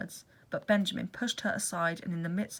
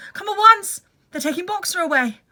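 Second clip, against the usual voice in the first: loud, high alarmed shout; urgent shout; 12 semitones higher, 12 dB louder than usual. Acted. Benjamin warning them Boxer is taken, loud alarmed shout. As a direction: cry the alarm across the field. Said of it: "he shouted".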